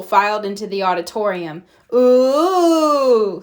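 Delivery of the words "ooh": a loud, drawn-out ooh; ooh of wonder. A narrator's reading. Kids in the dark auditorium, a wondering ooh. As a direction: gasp in awe at the dark. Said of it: "said the kids".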